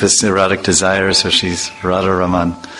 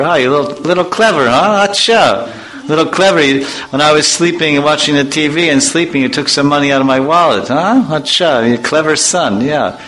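a lightly voiced, higher teasing father; scolding father; 6 semitones higher than usual. A father catching his son who took wallet money, lightly teasing. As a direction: tease him like a dad who caught you.

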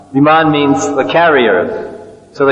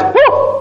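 calf squeal jumping far higher; playful calf whoop; startled calf cry. Retold or imitated. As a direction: yelp like a frightened calf. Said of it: "The calf goes".

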